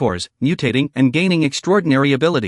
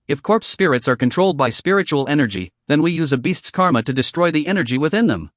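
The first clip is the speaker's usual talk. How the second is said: ordinary delivery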